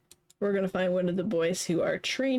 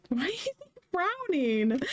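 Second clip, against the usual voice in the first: high playful; 10 semitones higher than usual. Acted. Playing character, teasing NPC.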